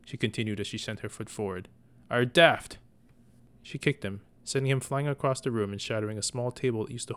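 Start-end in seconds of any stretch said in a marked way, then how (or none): 2.1-2.8 loud furious retort. Acted. Mother kicking her son and yelling at him. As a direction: spit it out as she kicks.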